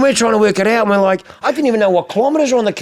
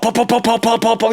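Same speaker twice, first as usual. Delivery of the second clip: quick string of higher popping noises; imitated exhaust pops; 6 semitones higher than usual. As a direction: mimic a car exhaust crackling on and off. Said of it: "It was like, pop".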